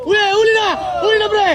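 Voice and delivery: excited call-out. Real, not acted. Calling out to players on court.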